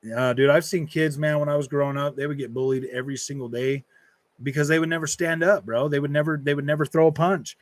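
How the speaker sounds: ordinary talk, nothing marked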